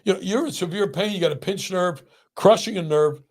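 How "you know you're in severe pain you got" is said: indignant outburst. Real, not acted. Outraged rant about therapy rule.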